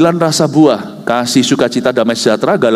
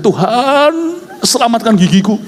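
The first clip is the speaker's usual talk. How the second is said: mock prayer; 10 semitones higher than usual. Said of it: "Saya bilang".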